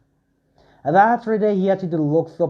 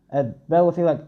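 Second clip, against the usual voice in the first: usual speaking voice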